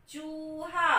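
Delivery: चूहा with the ऊ held long; long oo stressed. Shown as a demonstration. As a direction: stretch the long vowel in चूहा. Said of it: "ऊ की आवाज आ रही है वो थोड़ी लंबी".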